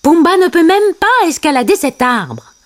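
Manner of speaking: high-pitched character protest; mild scoffing cry. A narrator's reading. Timon doubts Pumba can climb, high mild cry. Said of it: "cria Timon".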